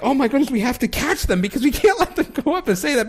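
imitating friends scrambling to head someone off; hammed-up alarmed friend; panicked. Friends panic to stop them.

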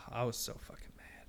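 sighed, voiceless admission; hushed, fed-up muttering; exasperated whisper. Real, not acted. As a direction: let it out on a breath, very soft. Repeats anger after a sigh.

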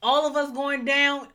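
usual speaking voice

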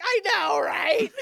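high, loud laugh-filled "I know, right?". Retold or imitated. Sister cheerfully agreeing with her sibling, laughing high and loud. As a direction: squeal it through laughter.

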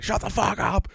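high pitched mock scream. He screams at girlfriend to shut up, high mock shriek. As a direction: shriek it, losing your mind. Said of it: "Like, just screaming".

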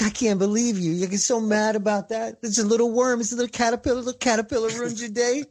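high, laughing ridicule over a caterpillar; God mocking Jonah. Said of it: "It's like".

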